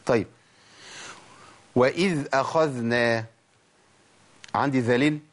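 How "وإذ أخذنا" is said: وإذ أخذنا slow, each ذ voiced and audible; clear dhal. Shown as a demonstration. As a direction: let both ذ sounds in وإذ أخذنا ring out. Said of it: "مطلوب إن أنا أبين الصوت".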